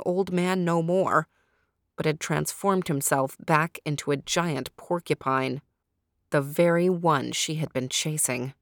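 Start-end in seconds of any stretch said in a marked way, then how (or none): none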